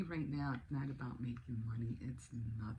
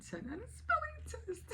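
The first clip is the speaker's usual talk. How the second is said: tearful pride. Real, not acted. Mom telling viewers about her son's score, crying. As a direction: voice breaking up high with happy tears.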